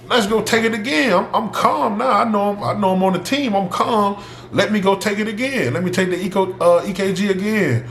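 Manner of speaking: high-pitched retold plea; urgent pleading. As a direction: insist on another chance, voice pitched high.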